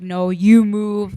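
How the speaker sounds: loud, drawn-out mock order; slow and forceful, imitating an armed man; retold defiant demand. Friend retells a gunman arguing with them, loud defiance.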